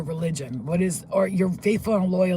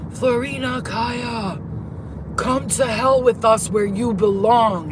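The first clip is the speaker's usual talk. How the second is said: loud, slow, drawn-out menacing shout; scary scream; 6 semitones higher, 8 dB louder, slower than usual. Retold or imitated. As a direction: imitate a scary giant bellowing a summons. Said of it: "He starts screaming".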